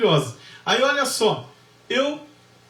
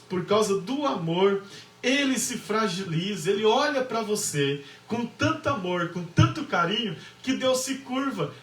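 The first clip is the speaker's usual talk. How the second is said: ordinary talk, nothing marked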